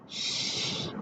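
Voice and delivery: unvoiced shush; hush. A narrator's reading. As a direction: hush him right away. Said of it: "Goose hushed".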